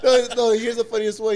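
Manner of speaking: laughing as he starts the best part. Real, not acted. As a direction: giggle into the punchline setup. Laughing while starting a story.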